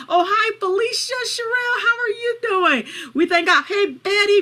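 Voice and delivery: high, bright-pitched hello; delighted greeting. Real, not acted. Host greets friends joining the prayer call, delighted. Greeting people as they join.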